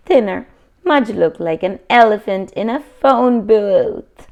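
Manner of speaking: ordinary delivery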